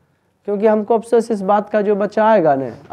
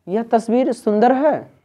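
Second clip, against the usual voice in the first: higher-pitched wonder, falling at the end; exclamation of surprise; 5 semitones higher than usual. Shown as a demonstration. Teacher showing students an exclamatory sentence, surprised. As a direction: marvel at how सुंदर it is. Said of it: "विस्मय कर".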